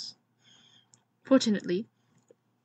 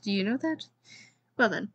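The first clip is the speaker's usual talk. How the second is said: plain reading voice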